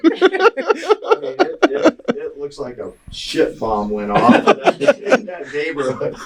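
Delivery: high, slow laughing. Real, not acted. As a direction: laugh through the words. Laughing during retelling.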